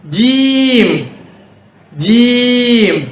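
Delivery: ஜீம் with the ee held long; long vowel. Shown as a demonstration. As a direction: stretch ஜீம் slowly on one level note. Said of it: "ஜீம் என்று நீட்டி உச்சரிக்க வேண்டும்".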